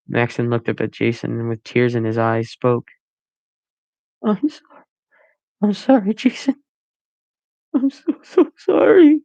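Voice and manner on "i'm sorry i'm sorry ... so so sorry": much higher, crying voice; sobbing apology. A narrator's reading. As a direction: beg forgiveness through tears.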